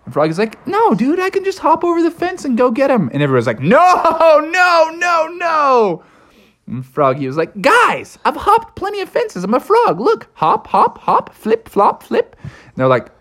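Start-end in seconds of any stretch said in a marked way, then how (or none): 0.7-3.1 very high, eager frog voice; Froggy the confident frog. A narrator's reading. Froggy offers to hop the fence, high eager voice. 3.6-6.0 high, loud drawn-out no's; alarmed group cry. A narrator's reading. Friends stop Froggy going over the fence, alarmed cry. 7.6-12.4 high, bouncy frog voice; Froggy the boastful frog. A narrator's reading. Froggy proves he can hop fences, high boastful voice.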